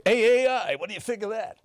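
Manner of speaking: hammy morning show host; cheesy host voice. Retold or imitated. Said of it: "morning show sidekick".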